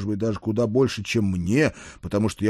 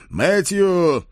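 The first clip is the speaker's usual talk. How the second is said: raised, higher call of a name; 9 semitones higher, 4 dB louder than usual. Acted. Lord summons his page from the next corridor, calling. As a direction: summon someone along a corridor.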